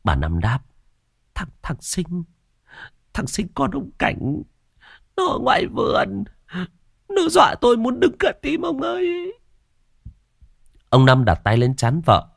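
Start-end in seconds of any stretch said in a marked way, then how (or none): 1.3-9.3 put-on frightened old woman. A narrator's reading. Mrs Năm insists to husband Sinh was in garden, frightened. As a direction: gasp it out, still shaking. Terrified wife recounts ghost.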